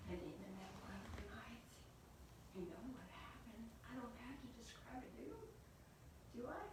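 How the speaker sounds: soft, confiding aside; quiet and suggestive to the listeners; hushed storytelling. A narrator's reading. Hinting at events in night.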